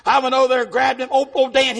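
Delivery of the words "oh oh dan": normal talking voice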